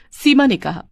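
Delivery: ordinary delivery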